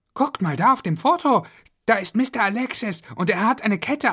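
put-on high, excited kid's voice; a kid puzzling out the code, eager; eager child. Kids work out the safe code together, eager and high.

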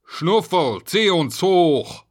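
loud, high, drawn-out shout; janitor's yelled order; calling out. A narrator's reading. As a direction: holler up the shaft. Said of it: "Jetzt rief er".

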